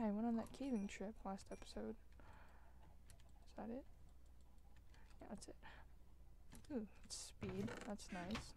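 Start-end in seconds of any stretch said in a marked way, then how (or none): 3.5-8.5 soft unvoiced whisper. Real, not acted. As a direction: whisper it to yourself. Whispering while brewing.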